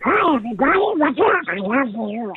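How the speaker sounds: high, garbled cartoon duck quacking; Donald Duck. Voice actor greeting fans, in a high quacking duck voice. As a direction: squawk it out as a squashed-cheek cartoon duck. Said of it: "I was doing Donald Duck".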